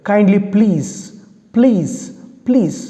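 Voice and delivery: mild retold pleading, please by please; pleading request. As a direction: imitate someone begging for a favour. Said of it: "They request the attender".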